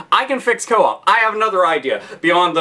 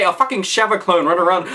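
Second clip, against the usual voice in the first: ordinary delivery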